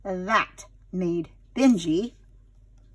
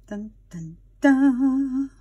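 suspense sting; 3 semitones higher, much slower than usual. A narrator's reading.

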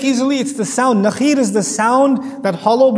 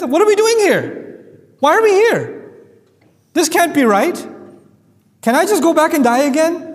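much higher, pleading confusion; stunned disbelief; 7 semitones higher than usual. Acted. Preacher voicing the dead waking up, higher and bewildered. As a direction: cry out like someone pulled from the grave.

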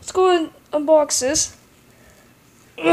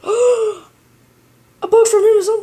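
high, slow, amazed delight; gasp, then drawl it out in awe; surprised gasp; 8 semitones higher, 5 dB louder, much slower than usual. Real, not acted. Opening a surprise package.

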